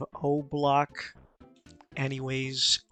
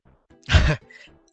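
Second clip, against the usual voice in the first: burst of laughter; 13 dB louder than usual. Real, not acted. Commentator laughing at troll.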